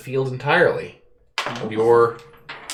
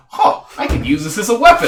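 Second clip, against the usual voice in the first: high, loud, fast dim-witted idea; mock realization; 11 semitones higher, 9 dB louder, faster than usual. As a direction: blurt it like a dumb discovery. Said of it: "you're like".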